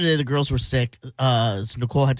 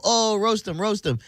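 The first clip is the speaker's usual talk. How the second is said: higher-pitched mock hype call; playful egging on; 7 semitones higher than usual. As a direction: tease them into a roast. Egging on the girls at home.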